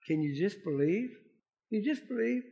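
softened, intimate pleading question; gentle appeal. Acted. Jesus asks the father to believe, soft gentle appeal. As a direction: ask it softly and let it sink in.

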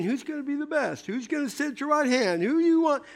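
mock-eager rivals; squabbling disciples. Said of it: "the disciples are always saying".

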